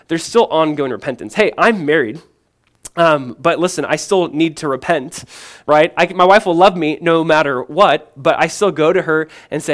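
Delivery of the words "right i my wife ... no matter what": ordinary talk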